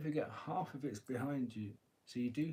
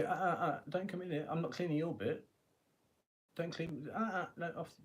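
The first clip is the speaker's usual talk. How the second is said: higher-pitched mock telling-off; warding someone off; 4 semitones higher than usual. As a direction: fussily shoo them off your patch. Acted warding off of intruders.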